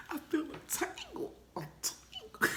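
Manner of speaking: high squeaky whisper. Real, not acted. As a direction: squeak it out giggling. Squeaky repeat of tingle.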